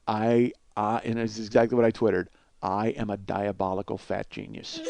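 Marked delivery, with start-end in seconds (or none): 2.6-4.8 lower, slower mock-evil gloat; hammed-up diabolical boast to the listeners; mock villain. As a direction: savor it like a cartoon schemer. Reading his own tweet.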